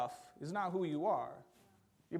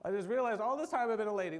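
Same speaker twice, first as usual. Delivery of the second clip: higher and louder mock gasp of discovery; mock sudden realization; 6 semitones higher, 6 dB louder than usual. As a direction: ham up a silly shocked realization. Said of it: "I was like".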